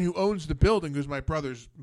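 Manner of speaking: usual speaking voice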